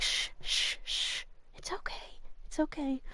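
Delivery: hushed, breathy shh into a whispered "it's okay"; shushing whisper. Retold or imitated. The mom hushing her crying baby, whispering. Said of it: "And the mom's like".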